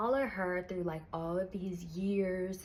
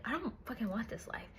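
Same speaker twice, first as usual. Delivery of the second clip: hissed whisper, much softer; whispered refusal; 8 dB quieter than usual. Acted. Heroine refusing her fate, whispered under her breath. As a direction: whisper it through the teeth in despair.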